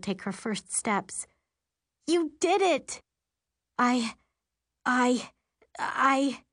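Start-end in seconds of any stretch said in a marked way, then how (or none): 2.1-3.0 thrilled. Acted. Thrilled at mom's magic. 3.8-6.4 halting, stuck on the word; stammering. Acted. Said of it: "my mom stammers".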